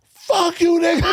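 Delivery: high, shouted comeback; mock angry shout. Partner blowing up at calm talk, mock angry shout. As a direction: imitate an explosive partner, voice shooting up.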